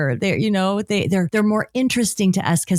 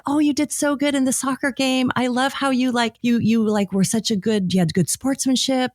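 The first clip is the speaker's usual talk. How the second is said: put-on high, bubbly praise for a child; proud parent cheering on a child; gushing praise; 7 semitones higher than usual. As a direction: gush over the kid like a proud parent. Praising the kids after soccer.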